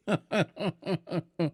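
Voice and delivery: faint, breathy jeering chuckle; a quiet laugh at the merchant's expense; soft mocking laugh. Sweeper chuckles to the gatekeepers, softly mocking. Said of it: "गोरंभ हंसकर द्वारपालों से बोला".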